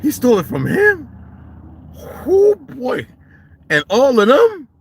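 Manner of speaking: rising shock at the news; surprised. Real, not acted. Hearing who the boy's father is, shocked.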